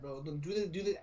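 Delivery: ordinary talk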